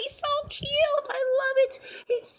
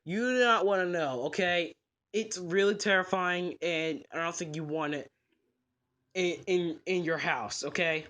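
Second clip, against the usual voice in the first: low, gruff pet store manager refusing; grumpy manager saying no; low shopkeeper voice; 4 dB quieter than usual. Acted. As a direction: play the stubborn manager down low. Manager warns about dog.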